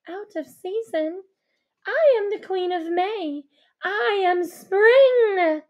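the offended queen howling; howled. Acted. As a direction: howl out who she is. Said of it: "she howled".

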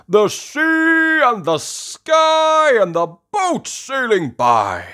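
loud, slow, high and expansive; Grampy Rabbit. Acted. Grampy Rabbit admiring sea and sky, loud slow relish. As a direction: stretch each sight out with relish. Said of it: "says Grampy Rabbit".

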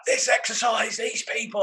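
high, mock furious rant. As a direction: imitate a friend losing his temper. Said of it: "he just let rip".

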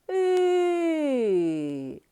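И held very long on a high, falling pitch; open vowel И. Shown as a demonstration. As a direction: sing out a long open И for the class. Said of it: "Ротик відкритий, голосний звук". (протяжний голосний звук И)

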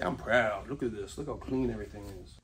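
soft, hushed pride; proud murmur. Retold or imitated. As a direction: murmur it, quietly pleased with yourself. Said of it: "you say".

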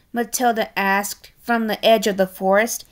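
usual speaking voice

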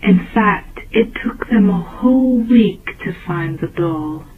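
plain reading voice

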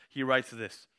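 ordinary talk, nothing marked

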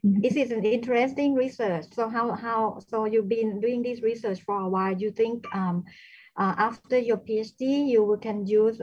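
loud, projecting voice. Real, not acted. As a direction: speak up so they can hear. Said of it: "can, can you speak louder".

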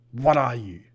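loud snarl, hit hard on the first word; snarled demand. A narrator's reading. Larton challenging the strange killer, snarling. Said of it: "Larton snarled".

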